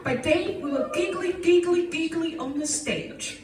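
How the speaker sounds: comic poem performance; playful recited poem over music; poem recital. Acted. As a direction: perform it like a cabaret number.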